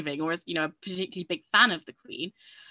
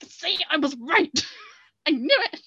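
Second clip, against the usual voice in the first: triumphant; 9 semitones higher than usual. Retold or imitated. Proven right by TV announcement.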